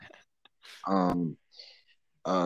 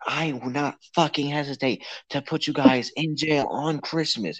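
higher-pitched retold yell, little added volume; mock yelling; 6 semitones higher than usual. Friend retelling a threat to call police, mild mock yell. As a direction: imitate an angry yeller at half volume. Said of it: "he yelled so loud".

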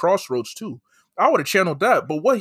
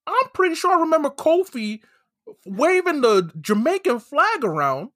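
high-pitched, disbelieving recollection; much higher, baffled retold reaction; incredulous retelling; 8 semitones higher than usual. Retells disbelief at wrestler's true origin.

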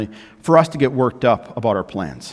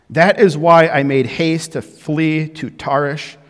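raised, measured Bible reading to the church; scripture reading; 4 dB louder than usual. Acted. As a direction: read the verse out with weight.

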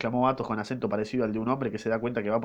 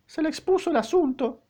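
odd high read; 4 dB louder than usual. Shown as a demonstration. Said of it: "No, se le expuso el asunto".

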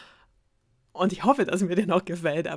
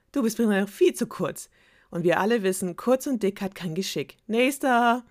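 spoiled princess; 4 semitones higher, 3 dB louder than usual. Acted. Princess insulting suitors.

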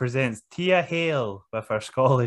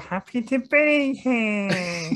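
higher, slow, giggly overjoyed rookie; Fraser impression; 9 semitones higher, slower than usual. Host mocking Fraser's happy-to-be-here promo, higher and slow. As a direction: drag it out, beaming with joy. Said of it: "he doesn't talk like that".

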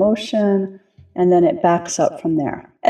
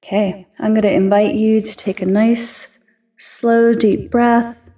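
calm grounding exercise. Acted. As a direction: ease them gently into their bodies, unhurried. Leads a grounding meditation.

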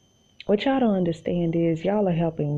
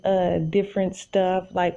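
ordinary talk

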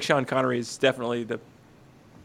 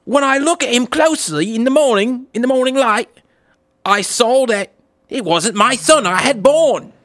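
higher, louder British woman's plea; mock British accent; 8 semitones higher, 6 dB louder than usual. A woman pleading to the king in a hammed-up British accent. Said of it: "sounds far better with some little British accent".

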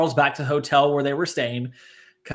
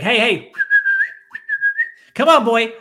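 high, louder call to a dog; calling a lost pet; 14 semitones higher, 5 dB louder than usual. Retold or imitated. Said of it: "he's like".

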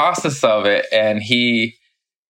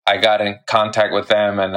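ordinary talk

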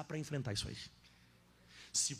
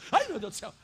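high, loud pretend fright; mock panic; 6 semitones higher, 11 dB louder than usual. Pastor picturing himself panicking while preaching on fear. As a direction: ham up the pastor's own panic.

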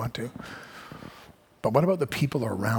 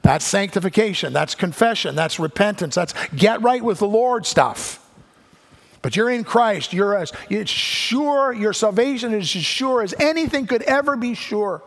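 higher and louder, driving every phrase; preaching at full force; 9 semitones higher, 5 dB louder than usual. Acted. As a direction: preach it out, rising to a peak.

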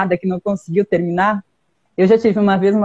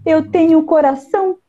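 higher sweet little bird voice; cartoon bird voice; 6 semitones higher than usual. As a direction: speak like a brave tiny bird. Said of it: "bird speaking".

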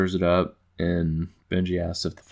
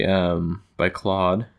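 normal talking voice

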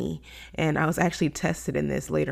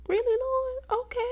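very high, squeaky mock disbelief; amazed disbelief. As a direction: shoot the voice way up at God in wonder. Retelling amazed reaction to God.

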